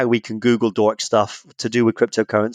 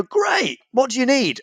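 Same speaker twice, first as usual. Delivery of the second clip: much higher, sing-song helpful agent; overly cheery agent; 12 semitones higher than usual. Retold or imitated. Phone agent satisfied by the scammer's answers, much higher and sing-song. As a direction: gush like a delighted help-desk worker.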